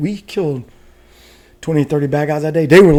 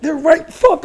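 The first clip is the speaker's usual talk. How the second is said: high-pitched frustrated mimicry; imitating an exasperated gunner, pitch jumping up; much slower than usual. Gunner who can see them but not hit, frustrated. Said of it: "That's why he was like".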